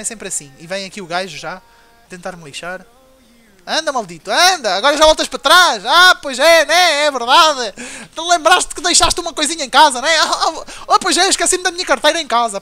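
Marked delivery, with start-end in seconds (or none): 3.7-12.6 loud sarcastic jeering with laughs; mock the coward who runs home; mocking taunt. Real, not acted. Gamer taunting a fleeing opponent, loud sarcastic jeer.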